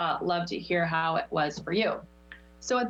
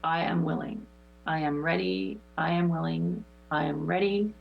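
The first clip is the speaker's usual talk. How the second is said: guided meditation. Acted. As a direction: lead the chant calmly, letting it fade. Leads affirmations and breathing.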